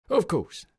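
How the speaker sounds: high, loud quick reply; hasty sergeant. A narrator's reading. Sergeant hastily answering his officer, high and loud. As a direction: answer in a rush.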